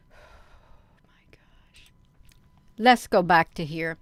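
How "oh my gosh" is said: breathless whisper of disbelief; whispered exasperation. Real, not acted. Streamer whispers to herself after a glitch, weary whisper. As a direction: whisper it to yourself, worn out.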